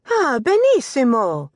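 high, bright Italian exclamation of joy; delighted cry. Acted. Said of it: "cried Anna Maria".

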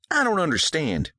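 bewildered daughter; confused girl. A narrator's reading.

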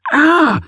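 sharp high yelp of pain. A narrator's reading. Stubbing her toe, she yelps in sudden pain. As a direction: yelp as the toe hits the bag. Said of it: "she yelped".

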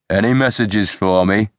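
usual speaking voice

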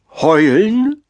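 high, drawn howl-like call; wolf calling out. A narrator's reading. Wolf answers the owl's question, rising howling call. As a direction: let the word climb like a howl. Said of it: "rief der Wolf".

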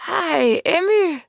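high, shrill greeting shout, modest volume; shrill call. A narrator's reading. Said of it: "Meget højt og skingert". (skingert, højt tilråb)

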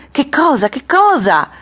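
high, loud cry of confusion; urgent high-pitched questioning; bystanders' anxious questioning. A narrator's reading. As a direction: call it out high from the crowd. Bystanders who missed it calling out.

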